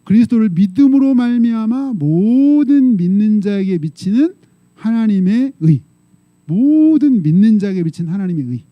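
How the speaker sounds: loud, slow congregational Bible reading; drawn-out, solemn recitation with a falling close; scripture recitation. Acted. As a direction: lead the verse in a measured, projected voice. Recites the verse emphatically.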